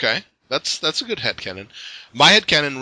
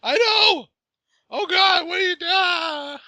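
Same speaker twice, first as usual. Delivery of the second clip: loud, very high-pitched squealed wail; distraught fan outburst; 5 dB louder, slower than usual. Real, not acted. Fellow fan reliving the broken ruby moment, distraught squeal. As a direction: cry out shrilly, dragging out the last words. Wailing over a sad comic moment.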